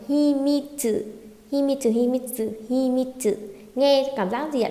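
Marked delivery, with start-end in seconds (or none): 0.1-3.3 "Himitsu" said fast, mi closed by the t as "mít"; quick linked reading. Shown as a demonstration. Teacher drills students on linked sounds, read quickly. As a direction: run "Himitsu" together as hi-mít-sư. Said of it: "chúng ta đọc nhanh với nhau này".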